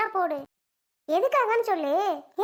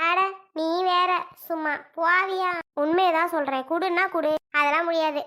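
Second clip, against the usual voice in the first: angry refusal. Acted. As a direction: shove the other away with your voice. Sister refuses to hand baby.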